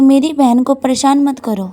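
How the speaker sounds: ordinary delivery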